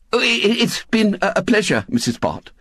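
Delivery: hesitant, stammering courtesy; nervous young man. A narrator's reading. Nervous young man takes leave of Missus Bott, hesitant. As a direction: stumble politely over the words. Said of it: "He threw her his nervous smile".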